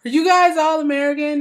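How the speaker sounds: high, loud excited call; excited drunk shout. Retold or imitated. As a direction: shout it like a tipsy stranger thrilled to meet you. Drunk Scottish guy quoted.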